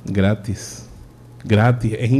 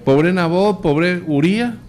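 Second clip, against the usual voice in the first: higher, exasperated protest; indignant disbelief; 7 semitones higher than usual. Real, not acted. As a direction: sound scandalised by the story. Preacher's outraged reaction. (incredulidad indignada, tono más agudo)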